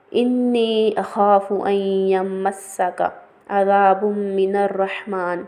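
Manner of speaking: very slow, drawn-out chanted Arabic; Quranic recitation. Shown as a demonstration. Teacher recites Ibrahim's plea to his father, slow chant. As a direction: recite each word slowly as tilawat.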